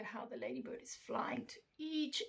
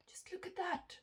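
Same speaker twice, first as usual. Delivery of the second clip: whispering; 8 semitones higher, 8 dB quieter than usual. Real, not acted. Aside to listener, hushed.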